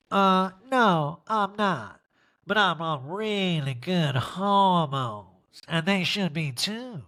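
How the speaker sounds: slow, put-on drawling denial; hammed-up impression, drawing the words out; mimicked housewife. Mimicking Erica's answer to producer.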